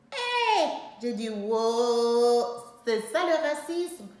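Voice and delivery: loud, high, drawn-out cry of outrage; stretched wow, shouted high; outraged shout. Retold or imitated. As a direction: let the shock burst out. Outraged exclamation at roommate.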